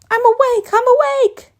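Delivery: sudden high shouted wake-up cry; startled yell. Acted. Startled John jolts awake.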